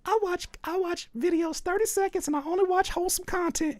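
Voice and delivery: squeaky-high imitation; high mocking voice. Mimicking friend's wholesome claim, high mocking voice. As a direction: pitch it way up to mimic innocence. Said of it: "At first you were like".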